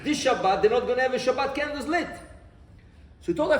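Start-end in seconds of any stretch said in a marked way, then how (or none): none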